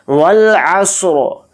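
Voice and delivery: slow, drawn-out tajweed chant; Quran recitation. Shown as a demonstration. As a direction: recite it slowly and melodically. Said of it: "स्वाद को भी हम मोटा पढ़ना है".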